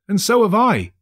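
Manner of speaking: put-on a boy chiming in; cried out. A narrator's reading. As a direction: blurt it out eagerly. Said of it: "cried Peter".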